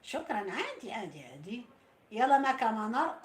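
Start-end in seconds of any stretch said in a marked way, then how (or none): 2.1-3.2 rallying call. Real, not acted. Rallying viewers.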